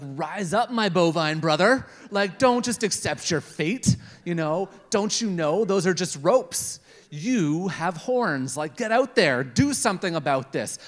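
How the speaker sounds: pep-talk the cow like a rebel leader; mock rebel rallying a bovine brother; rousing speech to a cow. Rallying the cow, getting worked up.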